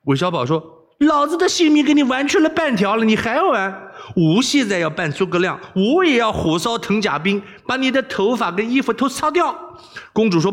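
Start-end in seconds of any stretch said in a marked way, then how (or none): 1.0-9.8 high-pitched, worked-up scolding; angry threat. Acted. Wei Xiaobao threatening to burn the princess's hair, angry. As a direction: rant up high like an exasperated rogue.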